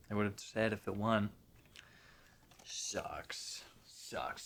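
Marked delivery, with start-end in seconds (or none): 2.6-4.4 soft unvoiced whisper; whispered grumble; whispered sucks. Real, not acted. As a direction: breathe it out disappointed. Muttering about Oscar snub.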